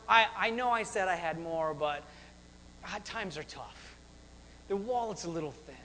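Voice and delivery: low, soft backpedalling; mock sheepish excuse. Imagined God excusing himself over money, mock sheepish. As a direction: trail down apologetically.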